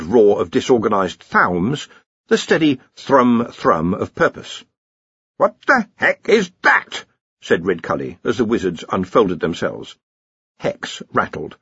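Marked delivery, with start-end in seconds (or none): 5.4-7.0 high, slow, puzzled bark of a blustering archchancellor; a startled head wizard demanding answers; startled old wizard. Acted. Ridcully startled by glowing sphere.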